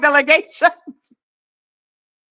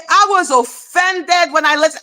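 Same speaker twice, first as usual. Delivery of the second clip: usual speaking voice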